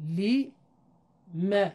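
لی ... م split into slow syllables; syllable-by-syllable demo; word by syllables. Shown as a demonstration. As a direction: break لی م apart for the class. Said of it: "اولین کلمه".

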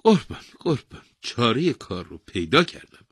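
high, loud, eager cry; overjoyed old man. A narrator's reading. Old man announcing his solution to the king, joyful. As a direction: burst out with glad news. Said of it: "پیرمرد با خوشحالی گفت".

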